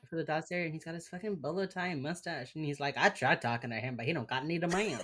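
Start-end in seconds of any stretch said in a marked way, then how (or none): 3.0-5.0 put-on Texas accent. Playing the hostage negotiator, Texas accent. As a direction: talk like a Texan lawman. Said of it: "in your Texas accent".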